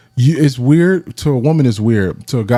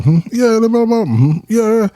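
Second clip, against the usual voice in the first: high, dismissive yeah-yeah voice; mock bored agreement; 12 semitones higher than usual. Speaker brushing off his friend in a high mock voice. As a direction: humor him in a much higher mocking tone. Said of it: "I'm like".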